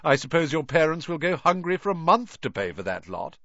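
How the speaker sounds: a lightly voiced spoilt boy taunting; sneering retort. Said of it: "retorted Malfoy".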